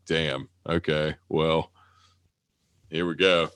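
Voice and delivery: ordinary talk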